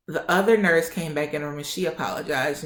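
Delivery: normal talking voice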